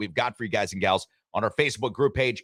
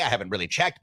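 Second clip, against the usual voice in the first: ordinary talk, nothing marked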